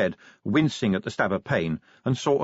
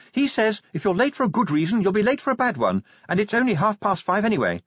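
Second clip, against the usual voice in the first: high-pitched anxious lady; Sybil's voice; 10 semitones higher than usual. A narrator's reading. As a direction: keep Sybil up high and brisk.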